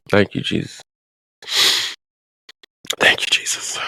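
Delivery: real whispered prayer of gratitude; whispered thanks. Real, not acted. Woman pausing her story to thank Jesus, whispering. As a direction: whisper it to Jesus, under the breath.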